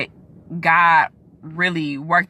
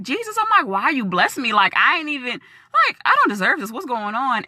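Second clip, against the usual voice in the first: amazed prayer; 7 semitones higher than usual. Retold or imitated. Talking to Jesus, amazed.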